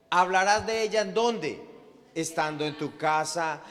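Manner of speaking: ordinary talk, nothing marked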